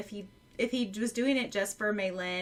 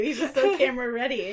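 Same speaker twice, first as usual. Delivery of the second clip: laughing; 3 semitones higher, 6 dB louder than usual. Real, not acted. Joking about character's looks.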